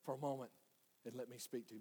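sinking to a soft, low plea; inner voice. Acted. Inner voice asking a driver for a moment, hushed. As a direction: ask it very quietly. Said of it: "hear that inner voice say".

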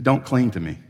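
low, softened plain request; said simply. Retold or imitated. Jesus repeats himself to Mary, speaking simply. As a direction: keep it simple, low and soft. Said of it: "he said simply".

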